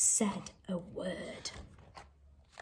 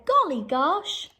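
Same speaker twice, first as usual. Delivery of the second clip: slow, surprised exclamation; surprised farmer; 6 semitones higher, 4 dB louder, slower than usual. Acted. Farmer woken by the splash, mild surprise. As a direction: wake up startled.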